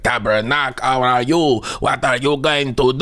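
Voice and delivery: thick comic Quebecois English; Quebec accent. Shown as a demonstration. Comedian demonstrating painful-sounding Quebecer English, heavy Quebec accent. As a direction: put on an exaggerated French-Canadian accent. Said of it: "when they speak English, it sounds".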